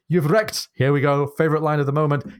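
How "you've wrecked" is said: high, indignant Scottish cry; lampooned Scottish. Acted. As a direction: burst out in a comic Scottish accent. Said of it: "slightly lampoon the Scottish intonation".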